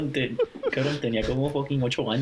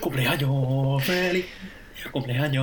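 cheering; 4 semitones higher, slower than usual. Real, not acted. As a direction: call it out like a party. Calls out birthday cheer.

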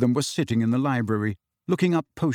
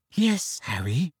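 gentle; 2 semitones higher, slower than usual. A narrator's reading. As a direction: ease him along with a slow, gentle reply. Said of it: "said Hermione gently".

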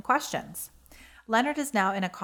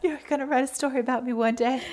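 laughing; 5 semitones higher, faster than usual. Real, not acted. Laughing tease.

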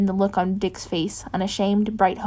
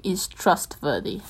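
slow, rough Batman growl, lower; rough, rock-like; 3 semitones lower, 3 dB quieter, slower than usual. Acted. Bruce turning to his rough rock-like voice. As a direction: grind the words out like rock. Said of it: "letting his voice shift into something rough, rock-like".

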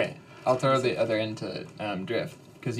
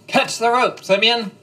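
full-throated yell, much higher and louder; shouting; 11 semitones higher, 10 dB louder, slower than usual. Acted. A rescuer yells to Simeon as the floor drops, shouting. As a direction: yell it across the room to him. Yelling rope to falling friend.

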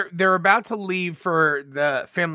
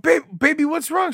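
higher, soothing concern; concerned parent; 9 semitones higher than usual. Retold or imitated. Parent comforting sobbing daughter, concerned and soothing. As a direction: gently ask the crying child.

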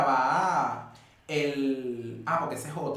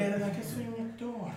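higher, drawn-out self-mockery; mock sheepish realization; 7 semitones higher, 4 dB quieter, slower than usual. Actor recalls being told not to direct, mock sheepish. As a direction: stretch it out in rueful mock surprise. Mocks himself after director's rebuke.

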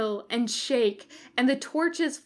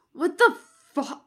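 loud, high, cut-off curse; angry outburst; 7 semitones higher, 6 dB louder than usual. Acted. Demon lands in the circle, shouting a cut-off curse. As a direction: bark it then choke it off.

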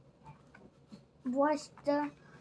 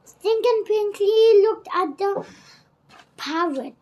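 ordinary delivery, nothing marked

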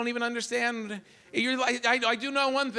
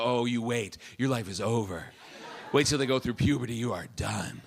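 warning women; 8 semitones lower than usual. Retold or imitated. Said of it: "women always go".

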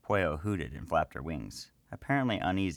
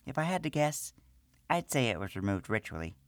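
high small gnome woman's voice, matter-of-fact; gnome priestess; 5 semitones higher than usual. Acted. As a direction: speak as the little priestess.

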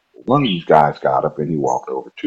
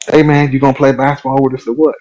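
other guy calling; 8 semitones higher than usual. Retold or imitated. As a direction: hail him from across the court. Another guy calls over.

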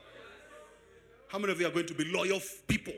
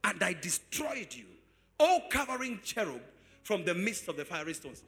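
preaching scripture; 10 dB louder than usual. Acted. Preacher reading Ezekiel to the congregation, loud and chanted. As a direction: declaim every line like a sermon peak.

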